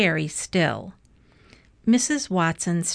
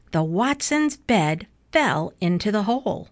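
plain reading voice

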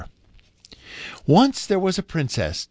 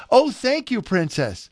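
delighted thanks; 9 semitones higher than usual. A narrator's reading. Jay thanks the princess for blue feathers, delighted. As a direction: open high with grateful delight.

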